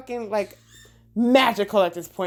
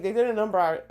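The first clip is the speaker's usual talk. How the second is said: normal talking voice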